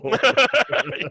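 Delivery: a short, high burst of laughter; laughing. Real, not acted. Teller laughing after quoting his friend. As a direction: let a laugh break out.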